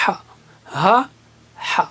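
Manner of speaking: ہا said light then breathy from the throat; teacher contrasting two h letters; two h sounds. Shown as a demonstration. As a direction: set the light ہا against the throat ہا. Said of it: "یہ ہا ہے اور وہ".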